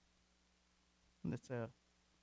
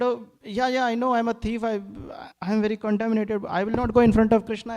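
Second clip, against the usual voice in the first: higher, louder, fast self-accusing outburst; anguished confession; 7 semitones higher, 8 dB louder than usual. Retold or imitated. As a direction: blurt out your shame. Thief confesses, ashamed and eager.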